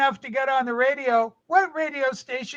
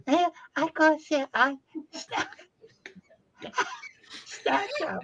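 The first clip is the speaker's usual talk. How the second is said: lightly put-on Yoko voice, rising high and fading soft; a hammed-up Yoko, trailing off; Yoko impression; 4 semitones higher, 7 dB quieter than usual. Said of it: "I'll talk as like I'm Yoko".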